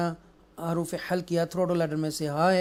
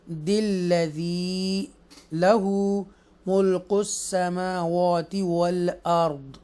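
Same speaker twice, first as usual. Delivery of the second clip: slow chanted tajweed reading; measured chanted recitation; Quranic recitation; much slower than usual. Shown as a demonstration. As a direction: recite it slowly and melodically. Recites verse in lesson.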